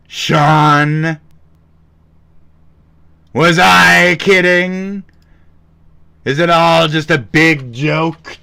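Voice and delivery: drawn-out mock confrontation; mock dramatic call-out. Streamer calling out a silent Sean on stream, mock outrage.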